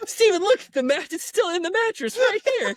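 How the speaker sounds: very high, loud mock panic; hammed-up high urgency; frantic skit voice. Friend showing the mattress on a video call, high mock panic.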